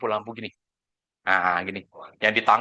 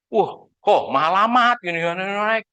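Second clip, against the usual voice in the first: high, mildly raised mock protest; retold outcry; 6 semitones higher, 3 dB louder than usual. Client crying out to agent over premium increase, mock outcry. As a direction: exclaim like a client shocked by the price. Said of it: "Teriak nggak dia? Wah, teriak".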